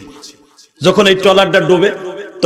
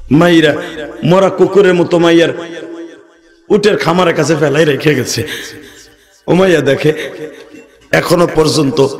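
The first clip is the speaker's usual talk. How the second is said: ordinary talk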